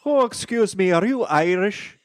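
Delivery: high-pitched hammy Irish voice; mock Irish accent. Trying an Irish opening for the show, mock Irish accent. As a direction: put on a silly high Irish brogue. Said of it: "with an Irish accent".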